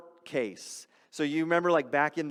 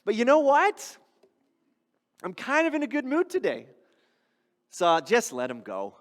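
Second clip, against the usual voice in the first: breezy, higher-pitched mock judge who shrugs off the case; lightly mocked careless judge; carefree judge; 5 semitones higher than usual. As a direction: play a judge who lets it slide on a whim. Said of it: "the judge just kinda goes".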